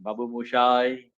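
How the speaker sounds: low, soft Babu Moshai; Rajesh Khanna, low tone. Shown as a demonstration. Teacher imitating Rajesh Khanna's famous line, low and soft. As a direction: murmur it low like the film star. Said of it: "और लो tone में बोलता है".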